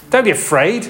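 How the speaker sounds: a high, scornful brush-off; condescending. Shown as a demonstration. Preacher mocks unhelpful reassurance, condescending. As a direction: say it as if they're an idiot. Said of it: "condescending kind of "You're stupid" kind of voice".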